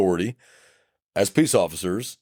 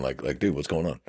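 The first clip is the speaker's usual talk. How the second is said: low, soft man-to-man question; low-key check-in; 6 semitones lower, 6 dB quieter than usual. Retold or imitated. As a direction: drop the voice and ask quietly. Officer acted talking to calmed man.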